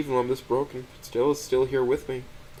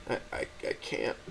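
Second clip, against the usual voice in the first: stammered, breathless whisper; barely getting the words out; dry-mouthed whispered stammer; struggling whisper; 8 dB quieter than usual. A narrator's reading. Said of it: "my mouth feels dry as I struggle to answer".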